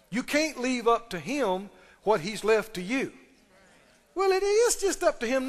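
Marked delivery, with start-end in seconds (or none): 4.1-5.5 pitched way up, a lazy believer's excuse; high sing-song excuse, mocking; mock whiny excuse. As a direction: mimic the cop-out voice.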